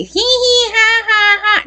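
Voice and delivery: high, squeaky taunting giggle imitated; mocking cackle. Rival's friend mocking her ruined date with a high cackle. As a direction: mimic the rival's teasing hee hee laugh. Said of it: "Doing this".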